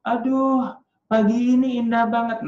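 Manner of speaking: high, loud fairy-tale princess. Shown as a demonstration. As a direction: exclaim like a delighted storybook princess. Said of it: "putri itu bilang gini".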